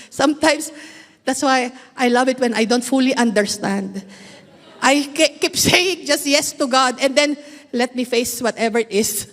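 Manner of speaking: ordinary talk, nothing marked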